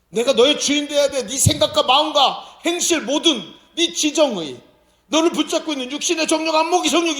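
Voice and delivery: high, loud, fervent sermon voice; full-force preaching. Acted. (힘차게 외치는 설교)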